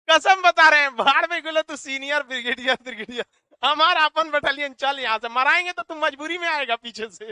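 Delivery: loud, hammed-up battalion order; mock military command. Teacher playing a brigadier rousing his battalion, mock commanding shout. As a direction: play at rallying the troops, loud and bragging.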